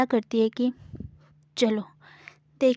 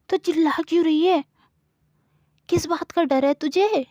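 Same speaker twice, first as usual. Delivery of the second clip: put-on higher, louder, quick exasperated girl; exasperated scolding; 5 semitones higher, 4 dB louder than usual. Samira hushes Gauri again, exasperated. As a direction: scold her fast, then calm her down.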